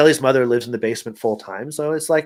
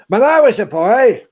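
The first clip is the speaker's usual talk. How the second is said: put-on high creaky old geezer; old man voice; 11 semitones higher, 6 dB louder than usual. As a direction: play a cranky old-timer. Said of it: "I sounded like some old guy".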